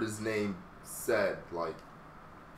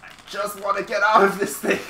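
frustrated yell; 9 semitones higher, 8 dB louder than usual. Real, not acted. Stuck in a hold, frustrated.

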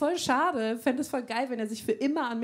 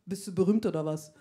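blunt brash question; 6 semitones lower than usual. Retold or imitated. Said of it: "Ich so".

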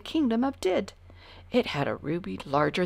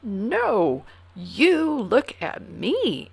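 slow, louder, drawn out; slow emphatic; 4 semitones higher, 6 dB louder, much slower than usual. A narrator's reading. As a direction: insist slowly on each word. King answers proudly.

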